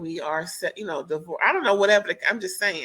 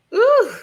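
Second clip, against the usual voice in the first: loud disgusted ugh; 9 dB louder than usual. Real, not acted. Disgust at husband's behaviour.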